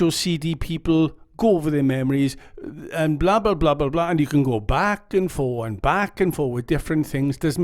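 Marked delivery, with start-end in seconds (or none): none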